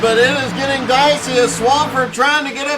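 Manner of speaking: projected race announcer voice; energetic live motorsport commentary; excited play-by-play. Real, not acted. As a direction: call the battle for the lead.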